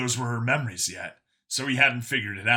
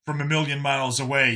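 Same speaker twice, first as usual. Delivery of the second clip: ordinary delivery, nothing marked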